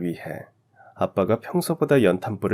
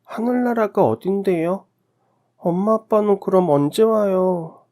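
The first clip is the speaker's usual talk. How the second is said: put-on high, slow-ending little child's voice; naive child; 10 semitones higher, 4 dB louder than usual. A narrator's reading. Orphaned child asks when parents return, innocent high child voice. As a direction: ask innocently as a small child, pitched high. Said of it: "순진하게 물었습니다".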